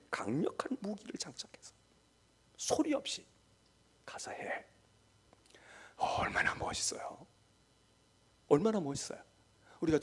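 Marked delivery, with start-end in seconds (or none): 0.1-4.6 soft whisper of God's command; a whispered divine charge; still small voice. Acted. 6.0-7.3 얼마나 멋있어요 in a soft whisper; whispering in awe. Acted. Whispered, then repeated aloud.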